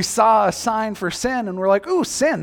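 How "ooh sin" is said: high, delighted ooh; a playful, tempted gasp of delight. Pretend. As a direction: coo over something tempting. Tempted by sign for sin.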